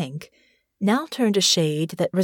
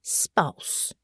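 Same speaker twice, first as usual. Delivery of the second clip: soft whispered echo of the word; whispered repeat; 3 semitones lower, 6 dB quieter than usual. A narrator's reading. Natasha echoing Honor, whispered. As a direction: breathe the word back quietly.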